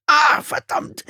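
high, loud pained curse; sharp yelp turning into a curse; cry of pain. A narrator's reading. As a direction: curse as pain shoots through you. Sudden stabbing pain at the door.

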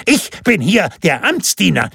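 high, self-important outburst; indignant official's squawk; shrill ranting. A narrator's reading. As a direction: insist on your rank, pitched high. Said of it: "zeterte der Dürre".

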